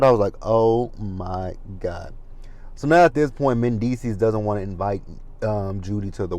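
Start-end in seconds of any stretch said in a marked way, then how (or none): 0.4-2.1 very slow, drawn-out exasperation; exasperated reaction. Retold or imitated. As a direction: drag out every word in disbelief. Retold exasperated reaction to her excuse.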